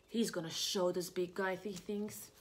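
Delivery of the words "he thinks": ordinary delivery, nothing marked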